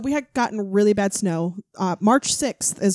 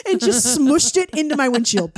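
laughing; 5 semitones higher, 5 dB louder than usual. Real, not acted. Laughing telling burrito prank.

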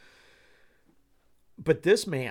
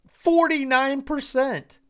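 disbelief; 11 semitones higher, 7 dB louder, slower than usual. Real, not acted. Stunned reading poll result aloud.

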